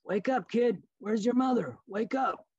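put-on high, gruff call to a sleeping kid; frog leader. Frog leader in the dream wakes the boy, high gruff call. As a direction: rouse the boy like a dream villain. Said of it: "The leader of the frogs said".

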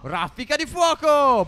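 slow, high, loud attack call; drawn-out shouted move name; battle cry. Pretend. Unleashing a fire attack.

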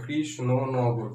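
slow "Krishnanagar", split কৃষ-ন-ন-গর; syllable by syllable. Shown as a demonstration. Teacher showing how Krishnanagar is pronounced, syllable by syllable. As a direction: stretch "Krishnanagar" and separate its syllables. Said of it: "উচ্চারণ করছো কিভাবে".

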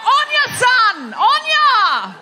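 high, loud Aussie cheer; shouted encouragement. Shown as a demonstration. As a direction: holler it like cheering someone on. Demonstrates the Australian shouted encouragement.

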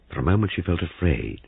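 usual speaking voice

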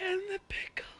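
high whisper. Acted. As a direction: hush it out. Drive-thru order bit.

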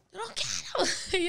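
put-on slow, drawn-out childish "no quiero"; whiny kid refusal. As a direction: sulk like a stubborn child. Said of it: "Y yo". (niña terca quejándose, lenta)

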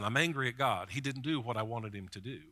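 ordinary delivery

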